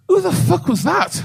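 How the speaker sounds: high, shouted mock nurse; shocked nurse. Nurse bursting in, shouting in shock. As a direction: yell it as a startled nurse.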